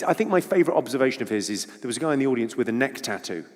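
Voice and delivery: ordinary delivery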